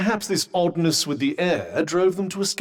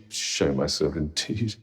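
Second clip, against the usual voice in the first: low, soft grumble to yourself; huffy aside, much lower and quieter; offended grumble; 12 semitones lower, 6 dB quieter than usual. Acted. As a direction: drop down and grumble under your breath. Grumbles after being told to appear.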